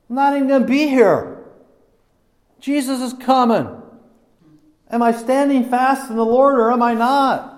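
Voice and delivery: high-pitched, urgent sermon delivery; fervent preaching. Real, not acted. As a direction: ring out each challenge to the congregation.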